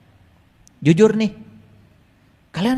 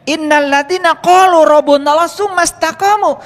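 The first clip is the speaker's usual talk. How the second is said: Quran recitation; 8 semitones higher, 5 dB louder than usual. Acted.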